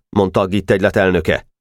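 plain reading voice

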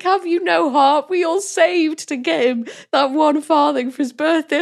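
mild mock plea; pleading mother. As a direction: imitate the desperate mother. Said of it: "the mum's like".

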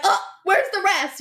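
high, loud, put-out disbelief; mock dismay. Imagined bride reacts to Post-it vows, high and loud in disbelief. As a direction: ask as if robbed of the vows.

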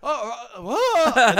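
high, loud, confused "what?"; baffled surprise. Retold or imitated. Recalling his confusion at a stranger, baffled shout. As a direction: sound totally baffled. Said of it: "I'm sort of like".

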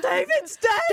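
high, loud gasping cry; dramatic cry. Retold or imitated. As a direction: gasp then wail. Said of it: "on Big Brother".

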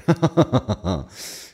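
high run of laughter; a long, rattling burst of giggles; laughing. Real, not acted. Host amused by own silly podcast greeting, laughing.

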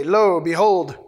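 mildly proclaimed announcement; calling for attention. Acted. Showing how ancients announced themselves, lightly proclaiming. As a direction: draw the room's attention with a measured call. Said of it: "I guess the way they did that".

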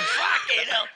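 loud shocked curse. Retold or imitated. Friend's shocked reply quoted.

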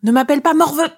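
high-pitched, indignant sidekick retort; retorting shadow. A narrator's reading. Shadow answering back to the dragon, high indignant retort. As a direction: snap back high and offended. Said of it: "essaie de rétorquer mon ombre".

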